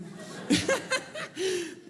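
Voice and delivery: real high-pitched burst of laughter; high bright laugh. Real, not acted. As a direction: crack up at the punchline. Laughing at his own story.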